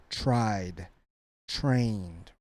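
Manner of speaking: "tried" and "trained" ending in a voiced d; final D sound. Shown as a demonstration. Teacher giving examples, final D on "tried" and "trained". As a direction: land "tried" and "trained" on a d, one syllable each. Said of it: "we have the D sound".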